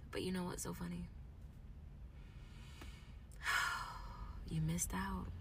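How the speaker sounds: soft, whispery after a sigh; hushed regret. Real, not acted. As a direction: drop to a whisper on a sighed breath. Quiet aside to those who left.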